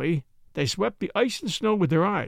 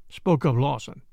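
ordinary delivery

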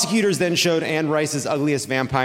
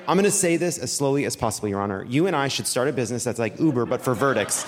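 quick, rattled-off testimony; speaking very quickly; 4 semitones lower, faster than usual. Acted. Don Junior pitches the judge a business, speaking very quickly. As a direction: rush through it at a fast clip. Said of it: "began speaking very quickly".

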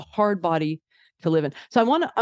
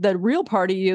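ordinary talk

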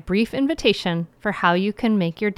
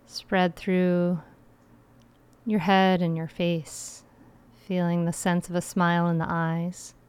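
guided meditation; 4 dB quieter than usual. Acted.

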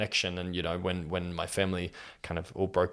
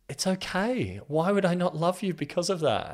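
1 reassuring sister; 10 semitones higher than usual. Retold or imitated.

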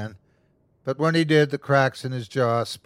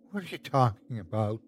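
quick, softer tearful question; through tears; 5 dB quieter, faster than usual. Acted. Bewildered wife questions the creature, through tears. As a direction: ask it quietly, close to crying. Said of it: "she asked through tears".

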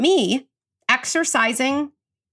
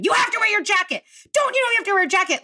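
put-on higher-pitched nagging at a child, picking up speed; mock-scold the kid about his jacket; scolding mother; 10 semitones higher, faster than usual. Mother scolding five-year-old over jacket.

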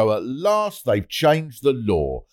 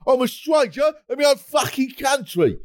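mock window shout; 11 semitones higher than usual. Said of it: "lean out of the window and shout".

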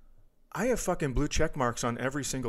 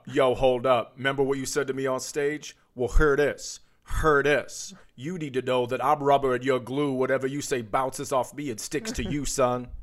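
louder hammed-up street threat; mock tough-guy callout; 5 dB louder than usual. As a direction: bark it like a playground tough guy. Co-host riffs the thug voice.

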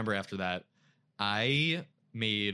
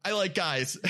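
high, louder nervous blurt that finally bursts out; 8 semitones higher, 4 dB louder than usual. Retold or imitated. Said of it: "I take a really big, deep breath in and I'm like".

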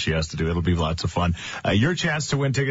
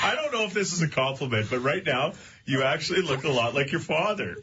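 laughing; 6 semitones higher than usual. Real, not acted. Laughing at Jack's stuffed face.

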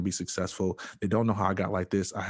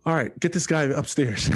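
imitated doctor order; 7 semitones higher, 5 dB louder than usual. Doctor orders patient taken away.

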